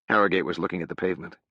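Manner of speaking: ordinary delivery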